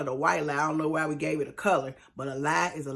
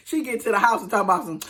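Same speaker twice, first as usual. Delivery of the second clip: usual speaking voice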